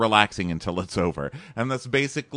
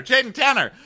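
much higher, excited name chant; mock excitement; 4 dB louder than usual. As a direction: start hyping the name in a high voice. Excited chant of couple's name, building.

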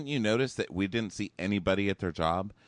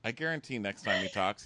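usual speaking voice

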